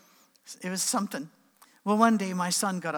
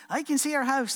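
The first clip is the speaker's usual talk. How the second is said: put-on high-pitched kid marveling at the view; lifted, childlike call; excited little boy; 9 semitones higher, 4 dB louder than usual. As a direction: imitate a boy calling out to Dad. Son calls from the base.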